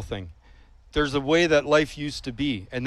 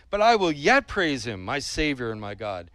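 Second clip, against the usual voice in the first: ordinary talk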